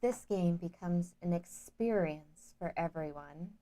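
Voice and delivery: lightly voiced Princess Leia, slow and soft; a hint of Leia's hologram plea. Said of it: "Say it in your Princess Leia voice".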